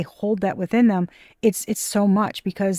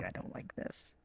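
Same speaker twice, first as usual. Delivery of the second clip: whispered dread; 22 dB quieter than usual. Real, not acted. Reacting to a bad card.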